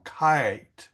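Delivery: KITE stretched out as "kiiite"; long I. Shown as a demonstration. Teacher explaining vowel, consonant, vowel, KITE with long I. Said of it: "The I is long".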